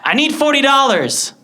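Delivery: high, loud, drawn-out demand; bratty demand. Acted. Boy demanding money from his mother, bratty. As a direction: demand it from Mom, slow and loud.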